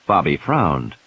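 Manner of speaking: ordinary delivery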